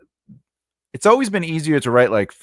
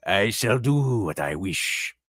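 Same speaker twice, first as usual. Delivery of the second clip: slow, hammed-up villain voice; theatrical, drawling self-importance; mischievous character; 3 semitones lower, slower than usual. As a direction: draw it out with mock menace. Reads villainous character line theatrically.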